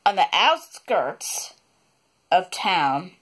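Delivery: outskirts said slowly, each sound careful; On the outskirts, slowed right down; slow careful retake. Shown as a demonstration. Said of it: "I can't believe I said it like that".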